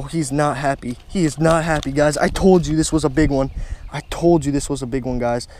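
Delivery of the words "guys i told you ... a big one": ordinary delivery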